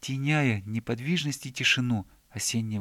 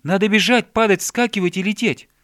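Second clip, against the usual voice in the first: loud, high, eager list; raised, exuberant exclaiming; excited shouting; 8 semitones higher, 11 dB louder than usual. A narrator's reading. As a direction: shout out a wilder game. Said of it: "крикнул медвежонок".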